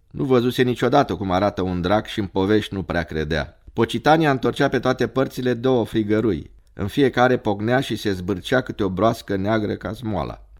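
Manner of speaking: ordinary delivery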